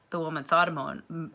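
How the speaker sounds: ordinary delivery, nothing marked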